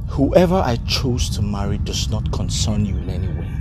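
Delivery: low, contained refusal; restrained, firm rebuff; cold defiance. Acted. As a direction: shut them out with a low voice.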